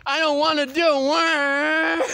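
slow, sobbing plea; crying plea. Real, not acted. Begging for choice time instead of work, crying. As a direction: plead through tears.

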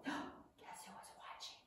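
soft breathy whisper after a gasp; suspense whisper. Shown as a demonstration. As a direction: hush the kids for a secret. Storyteller building suspense for kids.

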